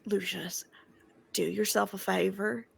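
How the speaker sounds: soft-started, lightly Southern character voice; South Carolina accent. Acted. Asking Lucius for a favor, South Carolina accent. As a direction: let a slight South Carolina accent slip in. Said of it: "I don't know why I'm speaking in this accent".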